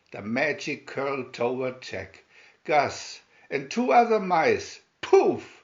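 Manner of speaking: ordinary delivery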